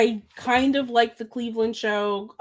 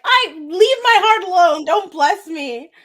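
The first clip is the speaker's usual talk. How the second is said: high, loud play-acted protest; hammed-up wounded retort; mock indignant; 8 semitones higher, 6 dB louder than usual. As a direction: protest in mock outrage, dragging out the end. Acted retort to being blessed.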